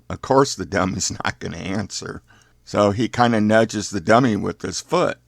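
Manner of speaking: ordinary delivery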